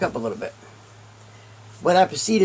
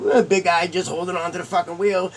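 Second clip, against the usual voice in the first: normal talking voice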